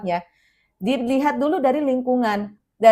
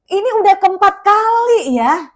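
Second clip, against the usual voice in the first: higher, louder, fed-up counting; a hammed-up irritated teacher; mock exasperation; 8 semitones higher, 8 dB louder than usual. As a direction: play the teacher who's had enough.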